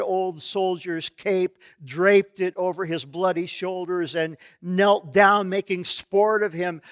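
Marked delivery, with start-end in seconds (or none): none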